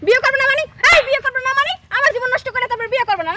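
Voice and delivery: high, loud indignant demand; outraged confrontation. Acted. Girlfriend confronting boyfriend who refuses marriage, high and outraged. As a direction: throw it at him in outrage.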